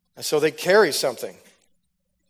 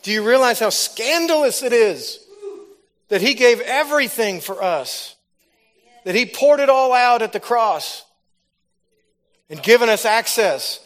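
loud, higher fiery preaching; full-force preaching; 8 semitones higher, 6 dB louder than usual. Acted. Preacher exhorting the congregation, loud and fiery. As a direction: drive it home to the crowd.

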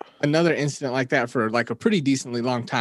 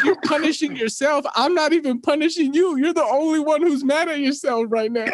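much higher, laughing while quoting mom; laughing through mom's words; 11 semitones higher than usual. Retold or imitated. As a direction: quote mom while cracking up. Voicing mom, cracking up.

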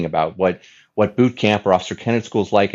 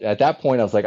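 ordinary delivery

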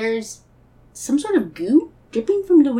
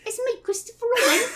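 high-pitched English schoolboy; little British boy accent; 10 semitones higher than usual. Acted. Christopher Robin greets Piglet in a little British boy accent. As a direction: announce yourself as a small British boy. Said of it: "I love your little British boy accent".

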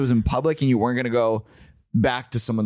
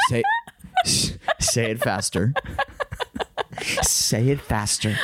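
slow, drawn-out mock pleading; auctioneer fetishist. Client with auctioneer fetish, slow lightly voiced urging. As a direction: urge them on slowly, as a fetishist. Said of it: "The guy who has an auctioneer fetish".

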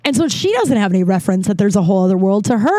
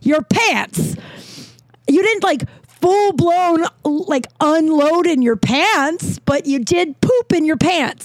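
exasperated explaining; 7 semitones higher, slower than usual. Retold or imitated. Lecturing friend in mock outrage.

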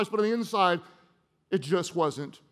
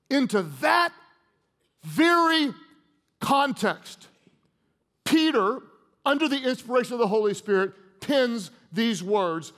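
preaching build-up; 2 semitones higher than usual. Acted.

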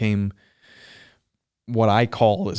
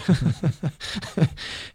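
a high laugh bursting out; high bright laugh; 8 semitones higher than usual. Real, not acted. Guest laughing at his own float-tank story, high laugh.

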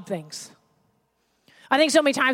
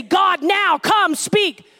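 mock prayer; 7 semitones higher, much slower than usual. Mocking overdone prayer ritual.

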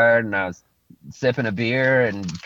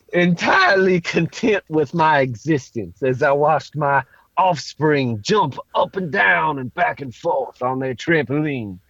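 put-on Creole accent. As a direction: drift lazily through it in a Creole accent. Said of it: "Tell me your terrific thought in a Creole accent".

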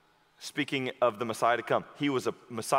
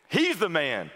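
normal talking voice

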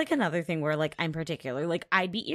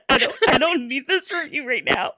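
laughing; 9 dB louder than usual. Real, not acted. Laughing at friend's teasing.

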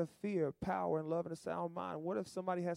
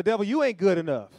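telling off the devil; 2 semitones higher, 11 dB louder than usual. Pretend. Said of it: "Tell the devil, say".

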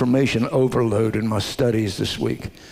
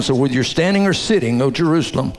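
ordinary talk

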